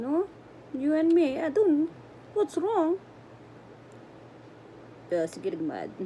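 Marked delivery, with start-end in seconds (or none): 0.0-3.0 pet talk. Real, not acted. Talking to pet in high voice.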